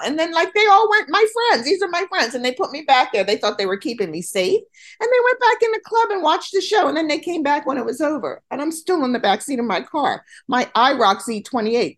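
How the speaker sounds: high, fast, animated retelling; excited storytelling. Real, not acted. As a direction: race through it all worked up. Excited retelling of embarrassing night.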